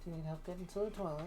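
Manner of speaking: fast quiet murmur; hushed and quick. Pretend. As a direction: rush it softly under breath.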